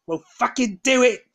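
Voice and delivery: loud, high angry yell; furious shout. Real, not acted. Venting at the government over Brexit, in a furious yell. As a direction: explode at them for real. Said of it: "This is why I get annoyed".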